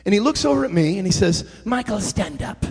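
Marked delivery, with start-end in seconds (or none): 1.7-2.6 imitating bishop. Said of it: "That's what he sounds like".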